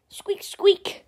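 high squeaks whispered under the breath; breathy tiny rodent squeak; whispered squirrel squeak. Pretend. The squirrel on its jetpack squeaks, whispered high. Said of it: "doing these sound effects all on my own".

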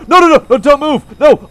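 high, loud panicked no no no; scared gamer shouting at the screen; frightened cry. Real, not acted. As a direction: yelp it in real fright. Chickens moving toward her.

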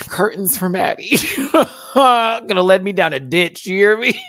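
high, loud, laughing exclamation. Real, not acted. Host joking about falling for the accent, laughing. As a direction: joke loudly through laughter.

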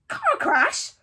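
high, loud indignant roar; Hagrid roaring. A narrator's reading. Giant reacting to the lie, roaring. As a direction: roar it in outrage. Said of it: "roared Hagrid".

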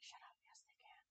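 very soft, almost whispered comeback; hushed retort. Retold or imitated. Streamer answering fiance about roaches, hushed retort. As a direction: shrink the protest to a whisper. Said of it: "And I'm like".

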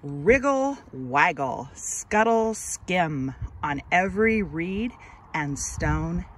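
put-on slow, expressive read-aloud for children; gentle storybook narration, drawn out; story narration. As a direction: read it like a picture book to little kids, lingering on each line. Reads picture book aloud.

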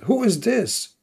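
high, sharp cry of who is this; angry question. Retold or imitated. Aisha demanding who he is, sharp and high. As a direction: Aisha snapping at the intruder. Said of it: "And she said".

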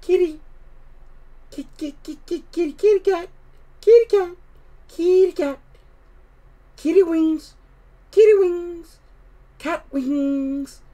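very high, sing-out coaxing; high-pitched cat call. A narrator's reading. Susan calling the winged cats, high-pitched coaxing. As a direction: call the kitties right up high. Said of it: "high-pitched voice".

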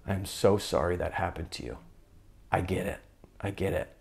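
soft, tender apology; compassionate. Retold or imitated. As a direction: gently console your hurt younger self. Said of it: "have compassion on this part".

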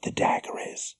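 hushed unvoiced whisper. A narrator's reading. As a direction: breathe the words without voice. Sneaking into a guarded room.

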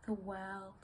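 hushed, halting, with long pauses; subdued dramatic monologue. Acted. Partner telling the other it's for the best, subdued and halting. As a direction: think aloud softly, trying to convince yourself. Performs audition monologue from Lungs.

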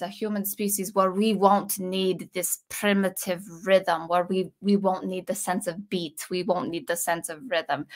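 put-on lightly done German accent, admittedly failing; a loose, half-hearted try at Hans Zimmer's German accent. Vlogger quoting Hans Zimmer's Dune interview, light German accent. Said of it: "I'm gonna try to do his accent".

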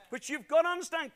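ordinary talk, nothing marked

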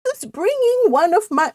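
high-pitched put-on voice; complaining cousin. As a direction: start a high, ailing complaint. Retrying Cousin Gladys's voice.

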